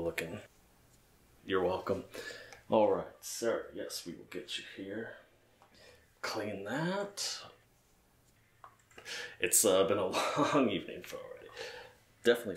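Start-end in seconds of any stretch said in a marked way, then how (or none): none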